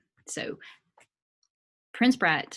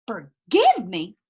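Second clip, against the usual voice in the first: slow, loud, disbelieving echo; Jimmy answering the prince, slow and loudly outraged; indignant Jimmy; 3 semitones higher, 9 dB louder, much slower than usual. Shown as a demonstration. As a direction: throw the word back at him, outraged. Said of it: "Jimmy is furious".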